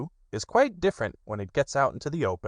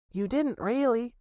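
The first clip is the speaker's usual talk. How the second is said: Piglet whispering. Acted. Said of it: "whispered Piglet to Pooh".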